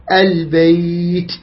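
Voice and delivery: البيت with a long smooth "ay" glide; lengthened leen. Shown as a demonstration. Teacher recites an example verse, gliding leen. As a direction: draw out the ي of البيت before the t. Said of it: "ياء ساكنة".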